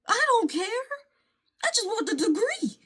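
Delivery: exasperated outburst. Real, not acted.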